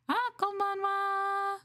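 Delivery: high, slow sing-song konbanwa; mock Japanese greeting. Streamer jokingly greeting chat in a high sing-song. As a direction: greet like a cute anime character.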